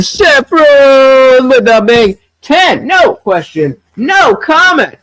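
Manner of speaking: usual speaking voice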